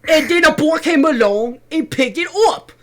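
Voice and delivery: hammed-up cockney yell for the busies; mock cockney. Cockney kid robbed of his letter, calling the police.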